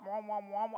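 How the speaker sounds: muffled wah-wah trombone voice, lightly done; Charlie Brown's mom. Retold or imitated. Teacher mimicking garbled voice the crowd heard. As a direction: mimic the garbled adult voice from the cartoon. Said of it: "Like, Charlie Brown's mom?".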